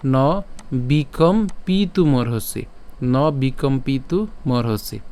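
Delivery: ন বিকম্পিতুমর্হসি stretched slowly, each syllable clear; slow recitation demo. Shown as a demonstration. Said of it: "উচ্চারণটা কেমন হচ্ছে".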